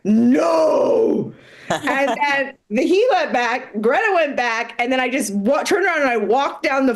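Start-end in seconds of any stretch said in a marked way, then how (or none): 0.0-1.3 firm shout. Retold or imitated. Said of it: "from a deep part of me goes".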